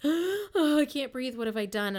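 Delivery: gasped, high, breathless panic; mock panicked gasp, then settling; retold panic attack. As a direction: act out a panic attack, loud and high at first. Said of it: "drive me into panic attacks".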